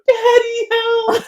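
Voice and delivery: high, loud frightened shout; scared child cry. Retold or imitated. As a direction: cry out like a scared little girl. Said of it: "I hear on the other side".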